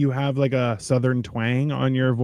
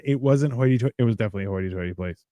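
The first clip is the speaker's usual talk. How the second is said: normal talking voice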